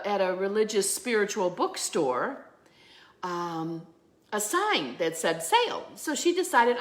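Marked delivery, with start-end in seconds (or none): none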